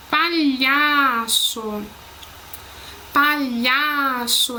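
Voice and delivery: slow "Palhaço" with LHA stretched long; teacher's slow demonstration of "Palhaço", stress on LHA; stressed syllable drawn out. Shown as a demonstration. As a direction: draw out the middle syllable of "Palhaço" each time. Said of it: "Prestem atenção quando eu vou falando".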